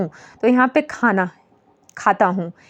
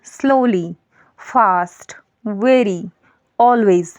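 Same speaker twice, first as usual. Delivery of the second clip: slow example word; much slower than usual. Shown as a demonstration. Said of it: "teacher lists adverb examples".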